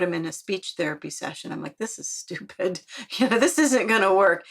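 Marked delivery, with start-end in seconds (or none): 1.8-4.5 retold disgruntled grumble rising in pitch; acted-out pessimistic griping; negative, dismissive. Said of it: "I was negative in that moment".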